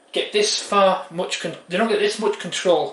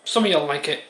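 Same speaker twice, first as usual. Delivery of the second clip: ordinary talk, nothing marked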